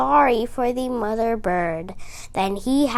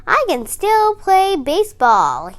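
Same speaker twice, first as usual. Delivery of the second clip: croaked; 6 semitones higher, 4 dB louder than usual. A narrator's reading. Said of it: "he croaked".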